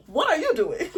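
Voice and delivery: high, laughing disbelief at her friend; laughing plea. Retold or imitated. Narrator to her bestie, laughing in disbelief. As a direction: laugh through the question. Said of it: "I'm like".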